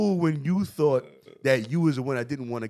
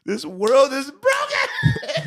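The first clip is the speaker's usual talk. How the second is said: slow, louder, laughing through it; laughing mock outrage; 7 semitones higher, 5 dB louder, much slower than usual. Real, not acted. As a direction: proclaim it slowly while cracking up. Laughing disbelief at clip.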